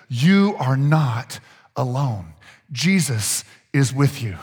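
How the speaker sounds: put-on slow, gentle reassurance to a child; reassuring parent. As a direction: soothe her slowly, word by word.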